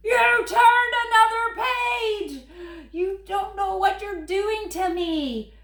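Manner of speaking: loud, high mock-anguished cry; dismayed wail. Grover cries out at the child for turning another page. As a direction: howl it like the world is ending.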